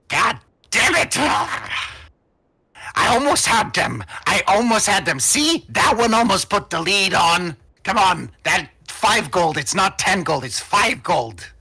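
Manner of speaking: high, loud exasperated outburst; very annoyed gnome. Acted. Aged gnome complains to the halfling about a bet, very annoyed. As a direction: protest hotly, pitch jumping up. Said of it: "clearly very annoyed".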